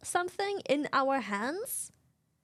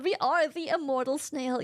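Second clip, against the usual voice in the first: ordinary delivery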